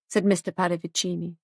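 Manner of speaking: ordinary delivery, nothing marked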